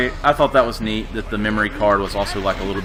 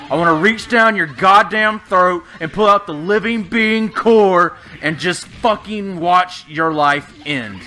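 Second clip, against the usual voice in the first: slow, louder, higher hammed-up menace; mock death oath; 6 semitones higher, 4 dB louder, slower than usual. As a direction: spell out each threat slowly, savouring it. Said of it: "I'm giving this fucking death oath".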